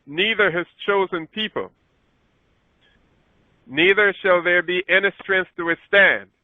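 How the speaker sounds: solemn Bible reading voice; scripture reading. Acted. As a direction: read the verse out like Scripture. Reads Daniel eleven aloud.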